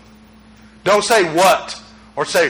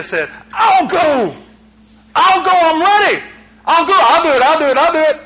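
eager volunteer; 8 semitones higher than usual. Retold or imitated. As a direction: jump at the chance like an eager kid. Eager Isaiah answering God.

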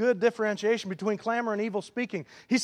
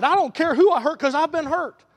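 higher, louder bitter cry; preacher voicing the shooter, louder; bitter shout; 7 semitones higher, 5 dB louder than usual. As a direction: throw it out in wounded defiance.

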